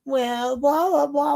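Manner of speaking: high, dismissive blah-blah; sneering mimicry of a lecture; mocking blah blah. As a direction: brush him off in a high mocking singsong. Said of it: "mocking the man".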